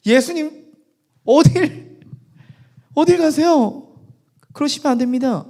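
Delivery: high, loud, halting plea; acted panic, stopping someone from leaving; stretched, high-pitched objection; alarmed protest. Peter protesting to Jesus.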